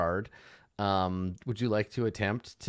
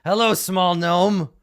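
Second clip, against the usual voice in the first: slow, loud, higher gnoll voice calling across; friendly gnoll greeting; 8 semitones higher, 9 dB louder, slower than usual. Acted. Gnoll waves and calls to the gnome across the river, slow and loud. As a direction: greet the little gnome warmly across the water. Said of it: "and he's like".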